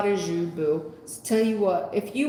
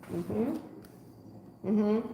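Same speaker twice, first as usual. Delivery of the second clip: ordinary talk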